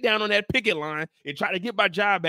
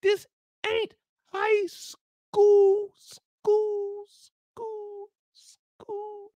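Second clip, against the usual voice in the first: high, slow and fading to almost nothing; high slow echo dying out; 9 semitones higher, much slower than usual. Shown as a demonstration. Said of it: "Remember that, y'all".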